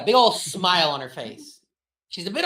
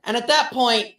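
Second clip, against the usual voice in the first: usual speaking voice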